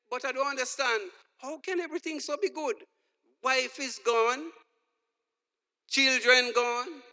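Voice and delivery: put-on wife, kids, money gone, and you're fine?; incredulous friend. Friend incredulous at his answer.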